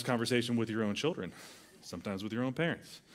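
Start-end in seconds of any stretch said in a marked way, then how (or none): none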